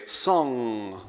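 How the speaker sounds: "Song" held on a long nasal ng; sit in the ng of "Song", feel it in the nose; "Song" ending in a buzzing ng hum; ng resonance. Shown as a demonstration. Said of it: "So say".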